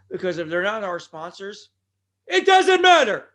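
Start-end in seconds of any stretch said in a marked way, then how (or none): 2.3-3.3 slow, much higher and louder yell; shouted catchphrase. Acted. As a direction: boom it out like the wrestler. Repeats his shouted catchphrase.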